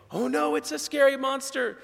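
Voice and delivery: high, hammed-up pretend fear; mock fright. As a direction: fake being scared of a small child. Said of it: "we pretend to be afraid".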